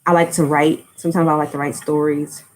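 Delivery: normal talking voice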